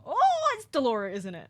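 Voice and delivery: excited. Real, not acted. Excited realization of character.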